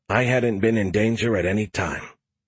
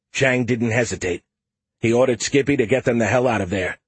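ordinary delivery

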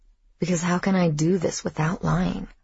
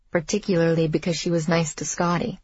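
plain reading voice

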